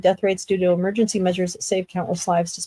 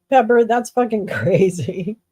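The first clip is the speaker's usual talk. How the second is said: usual speaking voice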